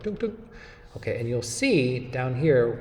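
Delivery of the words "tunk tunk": high little "tunk, tunk" clicks, like a mouse button; mouse click noise. Shown as a demonstration. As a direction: chirp the click sound twice. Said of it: "Just double click".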